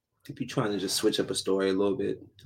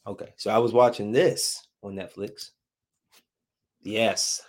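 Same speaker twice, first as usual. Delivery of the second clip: normal talking voice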